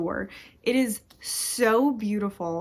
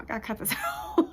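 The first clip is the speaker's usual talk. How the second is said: fast, much higher, breaking into laughter; laughing at herself; much faster than usual. Retold or imitated. Mocking her own crying, laughing high and fast. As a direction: let the line dissolve into a laugh. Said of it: "And then it's like".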